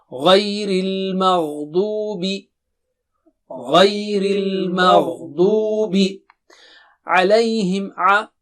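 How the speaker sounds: Ghayril maghdubi very slowly, each sound drawn out; slow model recitation. Shown as a demonstration. Teacher drills phrase slowly for students. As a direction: spell it out slowly for learners. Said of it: "So repeat after me once".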